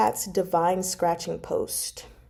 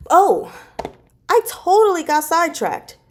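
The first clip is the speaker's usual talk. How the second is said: higher and louder oh of realization; real surprise at getting sidetracked; sudden realization; 11 semitones higher, 7 dB louder than usual. Real, not acted. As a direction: catch yourself mid-ramble, startled. Suddenly realises she forgot topic.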